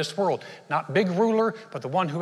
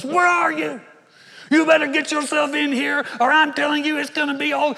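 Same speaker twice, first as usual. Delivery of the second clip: high, loud barking boss on the phone; angry boss yell; 11 semitones higher, 6 dB louder than usual. Retold or imitated. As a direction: bellow at the employee like a furious boss.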